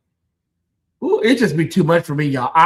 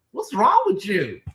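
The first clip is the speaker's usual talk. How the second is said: normal talking voice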